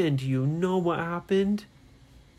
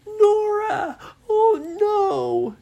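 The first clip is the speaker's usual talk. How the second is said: very high, loud wail; horrified cry; 8 dB louder, much slower than usual. Acted. Ryan cries out to petrified Nora, horrified wail. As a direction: cry out in acted alarm.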